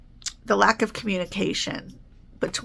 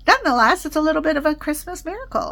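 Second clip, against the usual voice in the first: excited; 8 semitones higher, 4 dB louder, faster than usual. Real, not acted. Delighted about surprise check.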